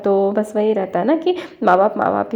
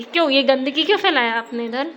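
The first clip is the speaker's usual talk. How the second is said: mock scolding; 5 semitones higher than usual. Scolding child about mess.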